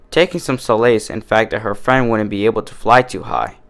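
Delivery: normal talking voice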